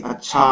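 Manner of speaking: "अच्छा" with its last vowel carried on; trailing intonation wave. Shown as a demonstration. Teacher demonstrating intonation to students, trailing wave on "अच्छा". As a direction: let the end of "अच्छा" ring on. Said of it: "अच्छा के बाद देखिए एक लहर चलती है".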